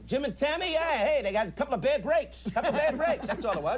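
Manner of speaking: a tough-guy dockworker act; brash street talk, in a high voice; cocky wise guy, pitched high. Acted. Playing a cocky wise guy to the caller Eddie.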